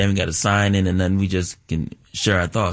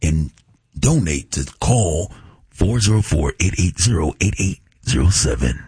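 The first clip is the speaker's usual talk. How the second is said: radio announcer character; 3 semitones lower than usual. Acted. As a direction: drop into a deep, measured station ID read. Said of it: "do that one more time".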